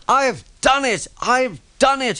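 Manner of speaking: high, joyful shout of victory; triumphant cry. Acted. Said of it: "he cried".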